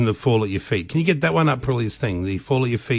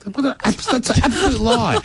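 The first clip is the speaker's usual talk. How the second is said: incredulous; 12 semitones higher than usual. Real, not acted. Mock outrage at claim.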